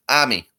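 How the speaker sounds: army as "AH-mee"; army with broad Boston ah; Boston pronunciation. Shown as a demonstration. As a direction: drop the r from army. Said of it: "how you spell army in Boston".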